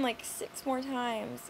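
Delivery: ordinary talk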